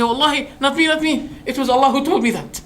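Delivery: laughing denial. Acted. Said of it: "I say".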